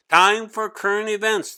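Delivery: teacher announcing current events to the class. Acted. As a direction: call the class to order. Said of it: "the tall teacher announced".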